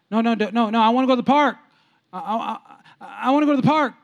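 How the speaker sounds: put-on high-pitched childish protest; insistent kid. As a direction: play a stubborn child refusing to listen. Voices insistent child arguing with God.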